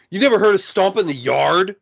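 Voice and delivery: high, loud mock disbelief; incredulous movie quote. College guy quoting a dance-movie trailer, mock incredulous. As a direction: imitate a trailer line, scandalized and loud.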